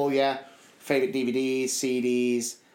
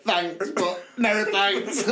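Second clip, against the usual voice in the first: dismissive; 5 semitones higher, much slower than usual. Retold or imitated. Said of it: "I was just a bit like".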